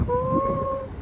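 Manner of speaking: high, slow thrilled squeal; excited squeal. Real, not acted. Vlogger shows viewers the beach, high excited squeal. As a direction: squeal it out, overjoyed at the view.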